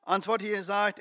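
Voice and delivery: ordinary talk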